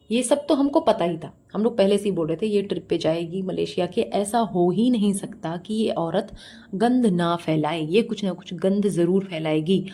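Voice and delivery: ordinary talk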